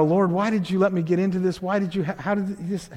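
softly pleading, dropping away; hushed questioning prayer. Acted. Believer asking the Lord about a broken marriage, hushed. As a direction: murmur it privately to God.

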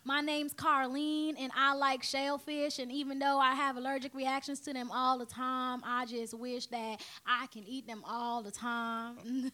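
slow, stretched Southern drawl; slow Southern accent. Shown as a demonstration. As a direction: draw every word out like a Southern belle. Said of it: "You could just do any. Go ahead, try".

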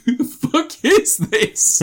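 high, slow stunned disbelief; incredulous shock. Retold or imitated. Retelling the family's reaction, stunned and high. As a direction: gasp out who she even is.

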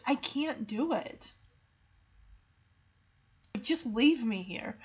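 soft, given-up voice; understated weary surrender; defeated. Acted. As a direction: give up, with the energy drained. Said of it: "gets to the point where he is defeated".

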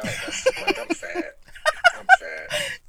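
high mock whine; mock whining. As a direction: whine it pitifully. Mock whiny self-pity.

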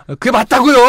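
insist, fed up; irritated insistence. Shown as a demonstration. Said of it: "약간 이렇게".

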